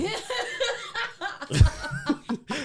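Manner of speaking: big burst of high laughter; real high-pitched laugh; loud high laugh. Real, not acted. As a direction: crack up loudly.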